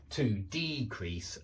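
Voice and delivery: "decrease" with stress moved to DE; long DEE at the start of "decrease"; DE-crease, even as the verb "decrease"; alternative verb stress. Shown as a demonstration. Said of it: "I can imagine people saying".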